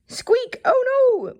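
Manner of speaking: very high squeaky yell; mouse yelling. Acted. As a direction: squeal it out in alarm. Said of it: "Mister Mouse yelled".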